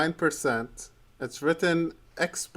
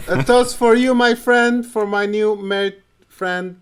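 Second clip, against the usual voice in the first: loud toast; 5 semitones higher, 7 dB louder than usual. Real, not acted. Raising a toast to Antonio.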